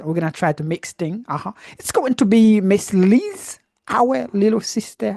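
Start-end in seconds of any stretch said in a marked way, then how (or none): 1.7-5.2 drawn-out, loud announcer's call; enthusiastic introduction. Real, not acted. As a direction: slowly build up the next speaker's name, raised and proud. Host announces next speaker.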